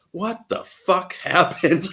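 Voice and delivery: high, slow, incredulous friend; friend's reaction. Retold or imitated. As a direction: drag out the disbelief. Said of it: "He's like".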